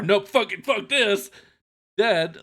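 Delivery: mock someone giving up on the spot; mock panicked quitting. Mocks the man giving up instantly.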